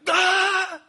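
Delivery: high, piercing mourning cry; sudden acted wail of weeping at full volume; loud demonstrated wail. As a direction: let out a high, sustained wail. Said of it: "when they weep, it's like".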